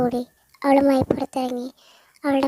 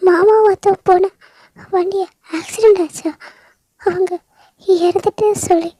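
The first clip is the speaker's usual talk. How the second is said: high, loud, tearful crying voice; sobbing grief; 6 semitones higher, 7 dB louder than usual. Acted. Veda telling Stella her parents died, sobbing loudly. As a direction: wail the news through tears.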